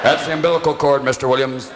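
low deadpan correction; matter-of-fact doctor; dry doctor. Acted.

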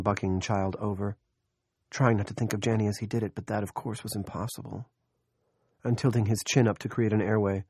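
plain reading voice

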